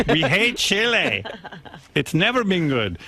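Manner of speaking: slow, high voiced miner; a lightly acted miner complaining. Miners refusing to come up.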